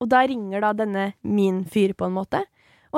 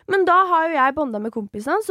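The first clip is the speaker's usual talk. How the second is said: ordinary talk, nothing marked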